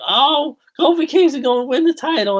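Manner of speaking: high, excited mock fan voice; imitating hyped-up fans; mock hype fan. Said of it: "everybody's like".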